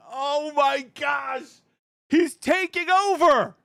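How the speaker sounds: very high, slow, stretched-out mock shout; hammed-up amazement. Retelling his reaction to a rival streamer's fame, hammed-up amazement. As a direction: draw every word out in a high exaggerated cry.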